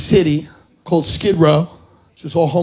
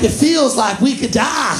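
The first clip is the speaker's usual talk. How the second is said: high, slow frightened cry; mock panic; 6 semitones higher than usual. The wife in the car on Skid Row, mock terrified shout. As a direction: play the scared tourist wife. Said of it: "She's like".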